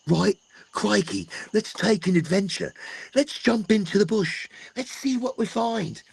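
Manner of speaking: a hammed-up Australian nature-show host; Steve Irwin impression. Musing to viewers while outdoors, a mock Australian Steve Irwin voice. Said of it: "Steve Irwin sometimes".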